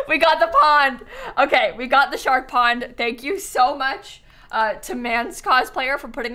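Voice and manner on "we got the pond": loud, laughing celebration; higher, louder, cracking up with delight; gleeful laughing. Real, not acted. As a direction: cheer it through real laughter. Celebrates getting the shark pond.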